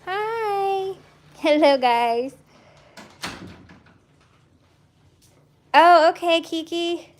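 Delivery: high, loud hello to the dogs; excited dog greeting. Real, not acted. Breeder loudly greeting the dogs as they rush in. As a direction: greet the dogs in a burst of delight.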